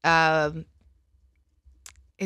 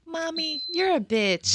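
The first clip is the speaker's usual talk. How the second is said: put-on kid voice; 9 semitones higher than usual. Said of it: "he'll be like".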